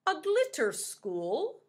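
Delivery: slow, high, loud musing; pufferfish voice. Acted. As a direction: muse on it like a puffer. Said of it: "said the pufferfish".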